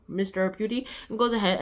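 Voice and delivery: usual speaking voice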